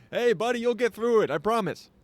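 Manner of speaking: cheery buddy voice. Retold or imitated. His cheery morning self to a homeless man, high and upbeat. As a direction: pump the guy up like a pal. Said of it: "dancing with some homeless guy".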